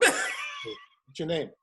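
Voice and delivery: very high, slow, laughing guard. Retold or imitated. Gate guard asks the newcomer's name, very high and laughing. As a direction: squeak it out like a bored gate guard. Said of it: "guard answers the greeting".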